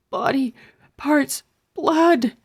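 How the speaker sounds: a touch of out-of-breath gasping; lightly breathless after running; panting. Acted. Stink, bent over after the run, pants mildly. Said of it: "he panted".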